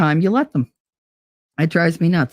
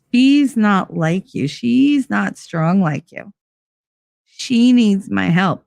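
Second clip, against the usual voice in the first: slow, measured mock parental reasoning; slow mock excuse; slower than usual. Imitating relatives justifying favoritism, slow. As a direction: drawl the family's excuse.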